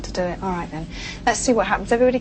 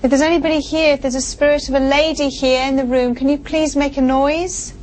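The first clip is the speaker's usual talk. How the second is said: calling out; 6 semitones higher than usual. Real, not acted. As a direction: call out to the empty room. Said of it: "[name] starts calling out".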